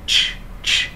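"Ch" as a hissed burst of air; ch không có ơ. Shown as a demonstration. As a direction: puff "ch" out without voicing any vowel. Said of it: "Chúng ta không có đọc âm ơ". ("Ch" bật hơi, không kèm ơ)

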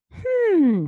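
high, loud hmm; pondering hum. A narrator's reading. Dentist inspecting the teeth, high pondering hum.

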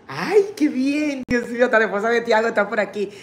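delighted surprise. Real, not acted. The tío's wife joins the stream.

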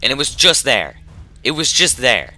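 high, loud exasperated yell; loud gamer frustration; frustrated outburst. Real, not acted. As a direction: complain loudly at the near miss. Frustrated after losing.

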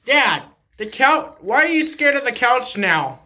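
ordinary talk

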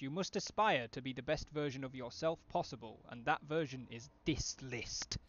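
a hint of a grand vampire lord; lightly voiced lofty vampire count. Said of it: "almost doing my, uh, Vampire Count's voice".

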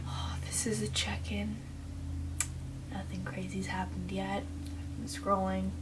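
hushed late-night whisper; nervous night vlog whisper. Real, not acted. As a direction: whisper so no one hears.

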